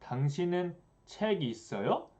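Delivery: "당신은 책 있어요?" with a high rise on the final "요"; statement wording turned into a question by lifting "있어요" at the end; question intonation. Shown as a demonstration. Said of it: "if I wanted to make it a question".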